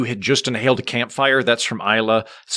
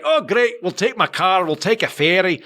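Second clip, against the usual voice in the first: eager, higher-pitched Scottish uncle; Scottish accent; 6 semitones higher than usual. Retold or imitated. Adopted Scottish uncle agrees to a trip, eager Scottish accent. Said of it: "your Scottish accent was very well done".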